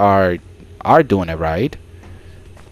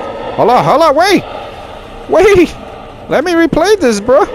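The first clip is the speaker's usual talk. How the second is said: frantic, much higher pleading; panicked. Real, not acted. Player chased by the monster, frantic high panic. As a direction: yelp it out as the monster closes in.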